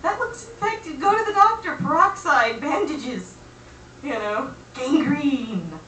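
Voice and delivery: hammed-up alarm, piling on the advice; mock panicked friends. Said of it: "they were all freaking out".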